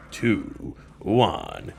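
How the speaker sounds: normal talking voice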